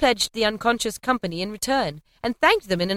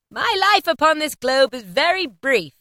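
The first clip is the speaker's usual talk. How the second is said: higher, louder solemn spirit voice; ghost's reply; 8 semitones higher, 5 dB louder than usual. A narrator's reading. Ghost answering Scrooge, solemn and raised. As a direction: speak as the fading spirit. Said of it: "replied the ghost".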